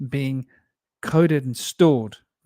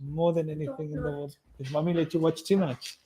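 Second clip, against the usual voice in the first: soft tender bedtime voice; pillow talk; 7 dB quieter than usual. Real, not acted. Parent saying goodnight to child.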